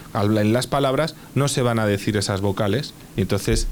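ordinary delivery

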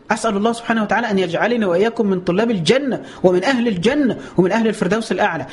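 usual speaking voice

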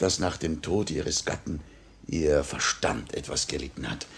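normal talking voice

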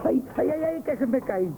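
sudden high yelp of alarm; startled cry. Acted. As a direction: cry out as things start falling. Clerk drops goods, startled.

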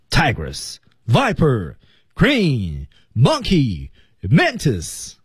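"Tigress，Viper，Crane，Monkey，Mantis", each slow and alone with a falling tune; 逐词停顿. Shown as a demonstration. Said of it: "后面每一个单词停顿一次". (“Tigress，Viper，Crane，Monkey，Mantis”每个词后停顿，降调慢读)

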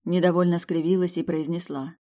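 usual speaking voice

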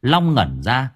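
plain reading voice

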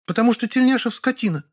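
high female character voice, cross; high-pitched, understated anger; angry. A narrator's reading. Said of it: "сердито ответила Лёля".

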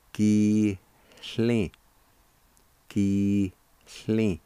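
"Ki ... hli", long first syllable, then a pause. Shown as a demonstration. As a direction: sound out "Ki, hli" in two held pieces. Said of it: "First part is pronounced like this".

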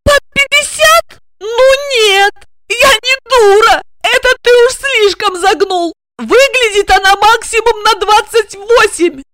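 high, loud agitated outburst; agitated protest. A narrator's reading. Regina protests to Igor about Olga's age, high and loud.

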